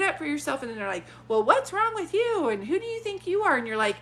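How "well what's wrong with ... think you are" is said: high-pitched mock indignation; indignant challenge. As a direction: imitate offended relatives, pitched up. Acting out relatives challenging the client.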